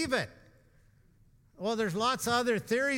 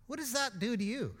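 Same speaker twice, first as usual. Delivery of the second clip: normal talking voice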